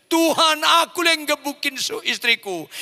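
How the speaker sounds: acted high, loud wail of confession; imitating a weeping husband, pitched high; tearful prayer. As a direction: cry out to God in remorse. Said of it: "dia nangis".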